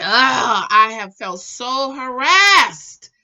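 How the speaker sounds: loud, slow, exasperated complaint; exasperated outburst. Real, not acted. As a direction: drag it out, fed up and harassed. She tells of feeling harassed.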